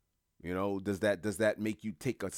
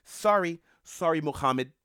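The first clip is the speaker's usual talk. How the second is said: high, loud fake-sorry brush-off; sarcastic high, loud imitation of Europe turning people away; mock dismissive apology; 10 semitones higher, 7 dB louder than usual. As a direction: wave them away with a chirpy sorry. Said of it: "Europe was like".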